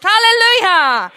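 loud, high, drawn-out praise cry; exultant shout. Real, not acted. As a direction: belt it out to the congregation.

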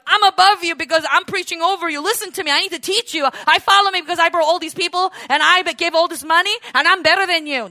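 arrogant preacher. Retold or imitated. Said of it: "always be like".

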